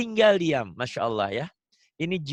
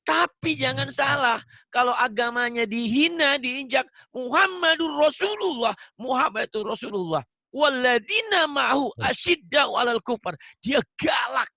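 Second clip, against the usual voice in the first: raised, higher-pitched preacher's voice; forceful preaching; 8 semitones higher, 3 dB louder than usual. Real, not acted. Ustadz warning about insults to the religion, forceful preaching. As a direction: drive the warning home emphatically. Preaches emphatically on defending the faith.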